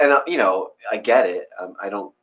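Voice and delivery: ordinary delivery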